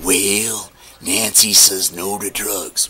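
a hint of Reagan's slow, measured delivery; impression of an old president. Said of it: "Remember this guy?".

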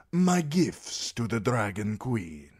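slow, showy and grand; flamboyant sellsword. A narrator's reading. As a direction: lay on the charm, drawn out. Daario voice.

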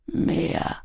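soft, indistinct murmur. A narrator's reading. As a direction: murmur it weakly, barely voiced. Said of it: "murmelte er".

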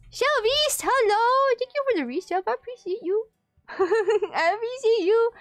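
high, bright thank-you to a fan; excited greeting. Real, not acted. Greeting a resubscriber.

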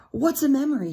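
put-on bright, eager little boy; child's question. Small boy asking the organ player. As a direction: ask like a curious child. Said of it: "he asked".

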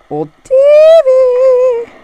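slow, high drawn-out call; singsong calling. Real, not acted. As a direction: coax it out singsong. Calling to lost TV.

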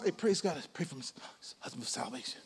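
fading to a whisper; hushed request. Retold or imitated. Pastor retelling the old lady's prayer request, whispered. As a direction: let it slip away into a whisper.

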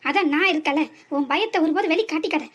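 ordinary delivery

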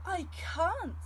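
high, loud sudden protest; startled protest. Acted. Rainbow fish refusing octopus advice, loud high protest. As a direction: blurt out the refusal.

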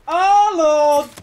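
drawn-out, high, loud cry. Real, not acted. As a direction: wail it out on the repeat. Repeats exclamation louder and higher.

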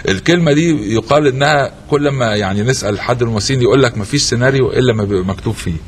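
low formal broadcast voice; announcer voice. Acted. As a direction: announce it like a TV host. Host announcer read.

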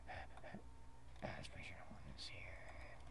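very soft, hushed self-talk; furtive whisper. Acted. Character checking no one is here, furtive whisper. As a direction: whisper as if sneaking in. Sneaking into a garage.

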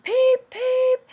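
high, loud whistle-like peep; bright high toy-engine peep. A narrator's reading. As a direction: sing it out like a little engine's whistle. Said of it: "Thomas whistled".

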